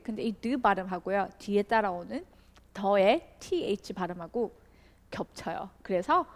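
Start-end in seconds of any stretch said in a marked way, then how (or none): none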